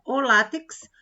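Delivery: ordinary delivery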